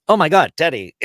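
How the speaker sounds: high, loud retold outburst of recall; excited recognition. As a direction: exclaim as an old friend comes back to you. Shatner suddenly recalls old friend.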